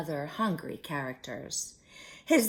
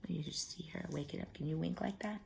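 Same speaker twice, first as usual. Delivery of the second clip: whisper; 12 dB quieter, faster than usual. Real, not acted. Aside to child, whispered.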